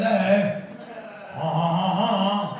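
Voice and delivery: mock maniacal laugh. Shown as a demonstration. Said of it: "It's just very fun to do that".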